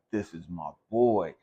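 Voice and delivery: slow, fond, arm-round-shoulder tone; affectionate pride in a friend; affectionate buddy. Retold or imitated. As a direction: say it like he's your brother. Affectionate take versus demeaning take.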